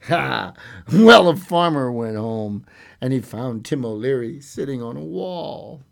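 lively storyteller voice, acting out the tale; story narration. A narrator's reading. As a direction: read it like a bedtime fairy tale. Storyteller reading a fairy tale.

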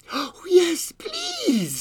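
put-on very high, eager little-girl voice. A narrator's reading. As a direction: pipe up like a delighted small child. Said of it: "said the little girl".

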